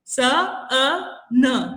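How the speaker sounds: "स, अ, न" as three isolated sounds; phonics-style sounding out of "स, अ, न"; separate sounds. Shown as a demonstration. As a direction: sound out "स", "अ", "न" slowly with gaps. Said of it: "अ sound न को एंड से और स को".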